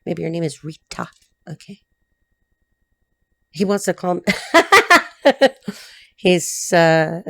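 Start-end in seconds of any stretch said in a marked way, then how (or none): 4.3-5.7 loud, high burst of laughter. Real, not acted. Laughs at being called.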